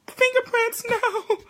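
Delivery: high squealing mock panic over fingerprints; mock horror. Recalling her clean house before kids, mock dismay. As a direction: shriek it like a fussy housekeeper.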